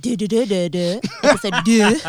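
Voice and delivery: hammed-up French repeating deux; mock French accent. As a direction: silly fake French, laughing. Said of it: "I'm French now".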